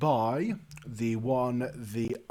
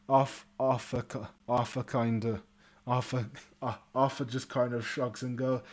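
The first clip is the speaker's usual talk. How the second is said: ordinary delivery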